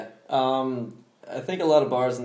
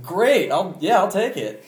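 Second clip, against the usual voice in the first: higher-pitched, eager retold yes; eager acceptance; 7 semitones higher, 3 dB louder than usual. Retelling a flower purchase to friends, mock-eager acceptance. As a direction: brightly act out accepting the offer. Acting out own past reply.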